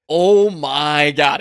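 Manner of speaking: loud, drawn-out 'oh my God'; amazed exclamation. Real, not acted. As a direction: stretch it out, laughing in disbelief. Amazed reaction to a shocking post.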